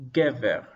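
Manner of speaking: "gever" with the accent on GE; stress on first syllable. Shown as a demonstration. Teacher marks the accent on the first syllable, stress on first syllable. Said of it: "the accent is on the first syllable".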